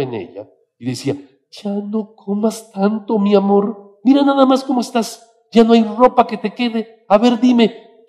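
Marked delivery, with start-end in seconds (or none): 1.5-8.0 high, loud nagging mother; scolding mother. Retold or imitated. Mother scolding her chubby son, loud and high-pitched. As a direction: nag the boy, loud and high.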